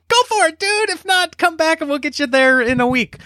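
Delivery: very high-pitched, overjoyed rant; exultant outburst. Acted. Traveler thrilled the agent lets him try, exultant. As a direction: cheer it on in a high, drawn-up voice.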